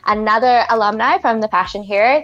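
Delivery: ordinary delivery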